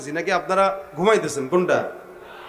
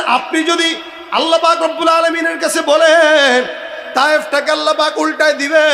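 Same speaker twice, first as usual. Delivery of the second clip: loud, raised-pitch sermon delivery; full-force preaching; 5 semitones higher, 6 dB louder than usual. Acted. Angel brings the Prophet an offer from Allah, full-force preaching.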